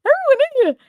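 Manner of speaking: high, loud mock amazement; shocked reaction. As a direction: retell it as a high-pitched exclamation. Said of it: "I was like".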